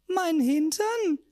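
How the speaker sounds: high, slow, loud aghast cry; high drawn-out shocked question. Acted. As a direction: blurt it out appalled. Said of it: "entwich es mir".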